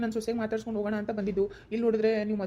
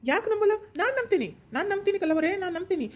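excited insisting; 9 semitones higher than usual. Acted. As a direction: burst in, insisting you believe her. Eager man insists he believes her.

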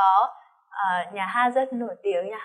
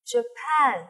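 Japan with the weight on PAN; stress second syllable; 2 semitones higher than usual. Shown as a demonstration. Teacher drills Japan, stress on the second syllable. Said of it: "nhìn theo tay cô này".

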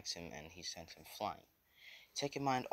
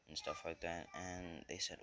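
usual speaking voice

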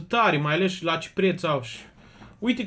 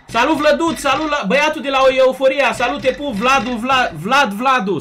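loud, higher-pitched shout-out; excited greeting; 5 semitones higher, 7 dB louder than usual. Real, not acted. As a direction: greet a buddy at full energy. Greeting a friend on stream.

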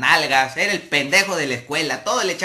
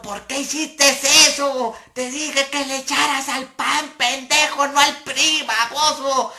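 high, loud voice scolding him; furious scolding; 10 semitones higher, 4 dB louder than usual. Retold or imitated. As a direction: chew him out for ruining the event. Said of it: "estaba emputado".

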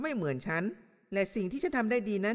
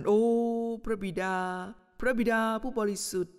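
mock sugary prayer voice. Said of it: "พอเธอเริ่มอธิษฐาน".

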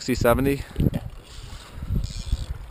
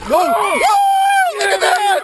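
excited shouting; 10 semitones higher than usual. Real, not acted. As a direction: shout it out, totally amazed. Shark hits boat, he shouts.

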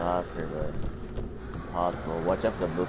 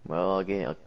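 ordinary talk

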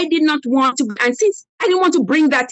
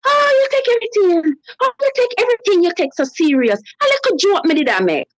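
mimicking husband; 7 semitones higher than usual. Husband's dismissive reply mimicked.

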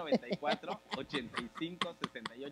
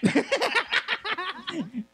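burst of laughter; 12 dB louder than usual. Real, not acted.

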